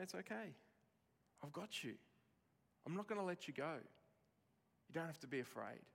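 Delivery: put-on soft, tender parent reassuring a child; calm a frightened child, voice well down; gently reassuring, hushed and steady; soothing reassurance. Father soothing his frightened children.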